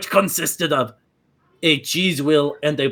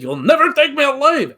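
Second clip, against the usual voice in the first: a much higher, loud defiant cry; mock heroic cry; 12 semitones higher, 6 dB louder than usual. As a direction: fling it out like a doomed hero. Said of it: "you said".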